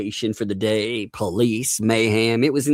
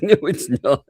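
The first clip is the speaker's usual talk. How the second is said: higher imitated retort breaking into laughter; laughing denial; 6 semitones higher than usual. Voicing the Aussie rejecting Outback food, laughing. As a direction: contradict through giggles.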